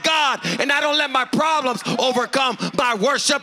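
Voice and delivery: full-force preaching. Acted. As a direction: preach it out, lifting the room. Preaching with music building.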